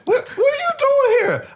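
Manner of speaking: high, laughing mock startled cry; Diamond's mock shocked squeal; startled woman. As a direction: squeal it like she's caught off guard.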